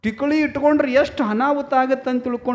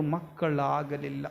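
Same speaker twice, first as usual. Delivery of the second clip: ordinary talk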